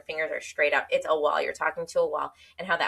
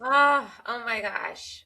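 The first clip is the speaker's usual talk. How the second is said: emotional; 4 semitones higher, much slower than usual. Real, not acted. Listener moved by her friend's story, emotional. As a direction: let out a moved exclamation, then soften. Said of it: "that made me so emotional".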